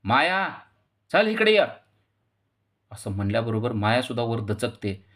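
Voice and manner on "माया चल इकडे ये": shouted name, much higher and louder; angry elder shouting for the daughter-in-law; loud call. A narrator's reading. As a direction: call her down from upstairs at full voice. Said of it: "जोरात हाक मारली आहे".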